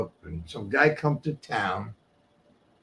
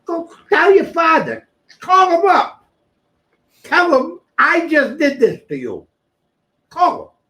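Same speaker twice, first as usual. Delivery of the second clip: higher, louder, slow: go tell your father; taunting yell; 9 dB louder, slower than usual. Retold or imitated. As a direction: bark the orders at him like a dare. Yelling at the kid to call his father.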